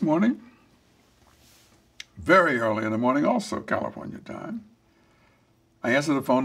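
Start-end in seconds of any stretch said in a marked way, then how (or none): none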